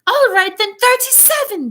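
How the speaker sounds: put-on cross spoiled child voice; tantrum brewing. A narrator's reading. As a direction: whine it high, close to a tantrum. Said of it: "going red in the face".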